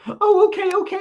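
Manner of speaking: high, bright, flustered okay; flustered young waitress. Retold or imitated. Young waitress nervously agreeing to a rock star, high-pitched. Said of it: "And she's like".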